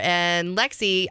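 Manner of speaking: usual speaking voice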